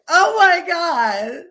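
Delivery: high, loud, drawn-out mock wail; mock crying. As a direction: imitate weeping at a romance movie, hammed up. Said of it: "crying, like".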